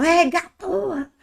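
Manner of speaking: high, slow drawn-out exclamation. Shown as a demonstration. Teacher contrasts the interjection with the vocative, high and drawn out. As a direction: let porra out as a stretched, surprised outburst. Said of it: "é interjeição".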